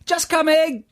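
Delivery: high called Just coming!; called reply. Retold or imitated. Guys answering a shout across the room, high call.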